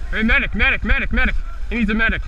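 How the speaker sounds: loud, high urgent shout for medic; calling for a medic. Real, not acted. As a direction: yell across the field for a medic. Teammate hit, calling for medic.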